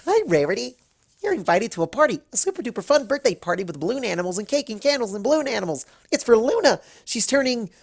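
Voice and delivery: high, fast, bubbly pony chatter; Pinkie Pie voice. Acted. Pinkie invites Rarity to a party, high and breathless. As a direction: gush it out like an excited party pony.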